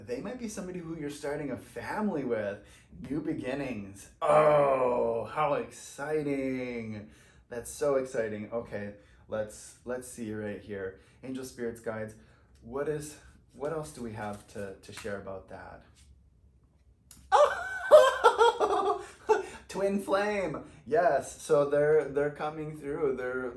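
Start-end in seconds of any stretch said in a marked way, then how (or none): 4.2-7.1 loud oh, then slow how exciting; excited. Real, not acted. Reader gushing to viewers over a card, excited. Reader thrilled by new beginnings. 17.3-19.6 squealing burst of laughter; loud high laugh. Real, not acted. Reader laughing with joy at her cards, loud squealing laugh.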